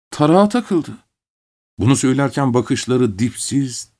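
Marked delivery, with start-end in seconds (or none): none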